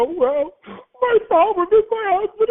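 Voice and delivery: high, slow, mopey client voice; client starting sob story. Retold or imitated. Client launching into her story, high and slow. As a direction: drag it out like a self-pitying client. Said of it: "they go".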